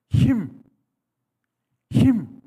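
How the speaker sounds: high, loud shout of 힘; shouted word. Shown as a demonstration. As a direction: bark out 힘 with full power. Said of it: "힘을 내는도다". (힘 하고 크게 외침)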